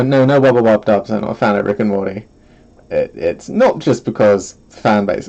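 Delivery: ordinary delivery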